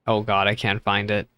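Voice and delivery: normal talking voice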